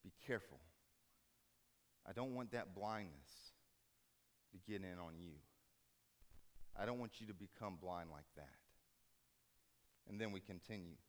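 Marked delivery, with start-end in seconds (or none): 0.0-8.6 soft, low, tender caution; gentle warning. Acted. Jesus to his disciples, soft and tender. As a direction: lean in and warn them softly. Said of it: "and he says".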